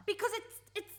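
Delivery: loud, high, stumbling start; cornered and blurting; a loud, flustered dodge; flustered outburst. Acted.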